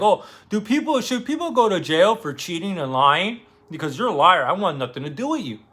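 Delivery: lightly hammed-up drunk taunt; mocking accusation. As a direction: sneer at a liar. Drunk accuses Dimitri of lying.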